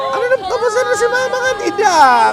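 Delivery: high, loud delighted hello; excited greeting. Real, not acted. Vlogger loudly greets the barbecue vendors, thrilled.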